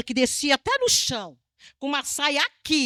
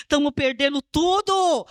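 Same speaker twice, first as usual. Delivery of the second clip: desperate sailors; 4 semitones higher, 4 dB louder, slower than usual. Retold or imitated. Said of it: "Esses homens estavam desesperados".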